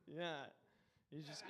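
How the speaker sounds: normal talking voice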